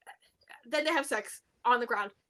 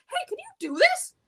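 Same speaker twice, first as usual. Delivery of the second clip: high, loud imitation of an annoyed captive; pointed demand, pitched way up; hammed-up impatient request; mock exasperation; 7 dB louder than usual. Handcuffed woman snapping at useless agent.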